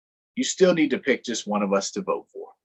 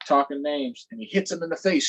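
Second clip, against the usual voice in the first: ordinary delivery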